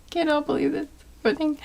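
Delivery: quick, high, hushed aside; embarrassed murmur. Real, not acted. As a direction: mutter it fast in disbelief.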